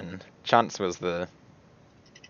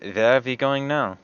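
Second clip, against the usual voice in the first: Hiada in character; 3 semitones higher than usual. Acted. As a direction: ask in character, unhurried and higher. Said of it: "and just say".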